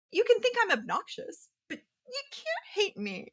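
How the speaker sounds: high, sing-song retort that slows at the end; mock high voice. Answering the vendor in a teasing high voice. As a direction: lilt it up high, teasing him.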